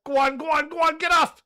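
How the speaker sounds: high, loud excited shouts at the screen; urging the ball on. Real, not acted. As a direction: yell at the ball to keep rolling. Urging a golf ball live.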